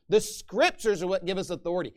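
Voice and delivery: usual speaking voice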